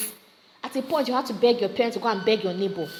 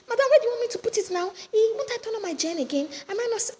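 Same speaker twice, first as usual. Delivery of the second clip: much higher and fast, exasperated; heated retort; 12 semitones higher, faster than usual. Retold or imitated. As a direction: fire it back in a rush. Shop neighbor quarrels over gen.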